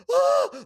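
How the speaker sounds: high, loud startled yelp; mock scared scream. Son startled by dad waking up, mock scared scream. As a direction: imitate a frightened kid's sudden shriek. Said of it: "Like, I scared him".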